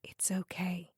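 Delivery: whispered comfort; soothing whisper. Acted. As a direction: hush him with a whisper. Counselor soothing sobbing client.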